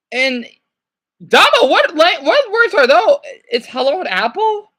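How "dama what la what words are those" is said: high, loud bewilderment; baffled outburst. Real, not acted. Baffled by the strange words.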